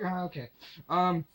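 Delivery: normal talking voice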